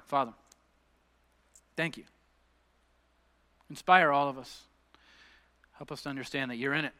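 hushed, unhurried congregational prayer; leading prayer. Real, not acted. Leads closing prayer.

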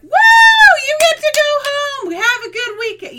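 high, loud whoop; cheering shout. Retold or imitated. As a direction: cheer a kid off for the weekend. Calling out to a kid leaving.

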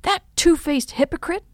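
high, indignant name-calling; angry. A narrator's reading. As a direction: spit out the insult, contained. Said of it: "I said to myself angrily".

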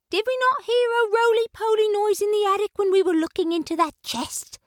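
high, fast sudden realization; Ribby putting the clues together; urgent neighbor cat. A narrator's reading.

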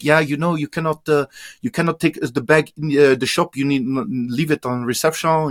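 imitating halting, French-accented broken English; mock French speaker struggling in English. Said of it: "in the really broken English".

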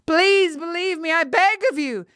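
high, insistent plea; desperate begging. A narrator's reading. Girl seeing oil poured on the pyre, desperate begging. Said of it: "her voice getting even more desperate".

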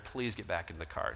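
low, soft, calm request; quiet plea. Retold or imitated. Husband softly asking his wife back into the car.

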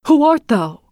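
high, startled cry of fright; terrified. A narrator's reading. Prince recoils from the ugly bride, high and terrified. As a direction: recoil in horror as you ask.

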